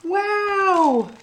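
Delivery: high awed wow; amazed child. Acted. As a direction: gasp it out in wonder like a little girl. Sophie amazed at baby squash.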